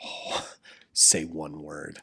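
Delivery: low, soft, slow menacing dare; low threat. Retold or imitated. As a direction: quietly dare the onlookers to speak. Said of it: "I was like".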